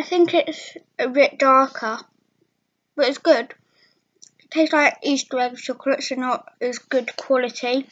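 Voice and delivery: talking between bites; chewing while talking. Real, not acted. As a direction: mouth partly full. Seen 1: Bites chocolate at mouth, then talks with lips moving over food.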